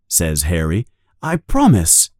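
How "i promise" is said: higher, fast earnest denial; protesting boy. A narrator's reading. Harry assuring his uncle, quick and earnest. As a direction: rush to promise.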